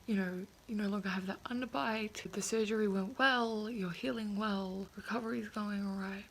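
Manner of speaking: ordinary delivery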